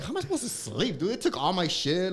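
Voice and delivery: high-pitched retold bewilderment; baffled complaint. Acting out his baffled complaint.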